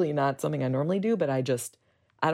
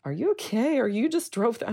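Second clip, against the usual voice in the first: higher-pitched, surprised officer's question; concerned cop; 9 semitones higher than usual. Retold or imitated. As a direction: imitate a startled cop checking on her. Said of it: "And he was like".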